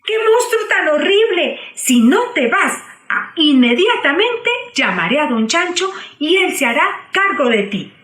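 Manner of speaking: frightened threat. Acted. Cerda threatens the monster.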